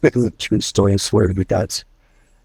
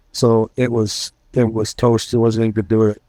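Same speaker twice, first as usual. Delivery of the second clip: ordinary delivery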